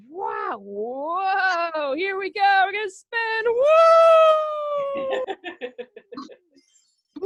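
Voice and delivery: thrilled, rising squeals of spinning; excited whoa. Pretend. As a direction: whoop as if spun round. Pretend spinning in whirlpool.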